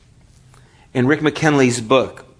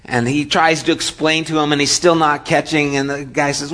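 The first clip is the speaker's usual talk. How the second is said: normal talking voice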